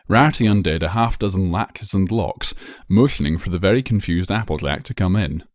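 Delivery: plain reading voice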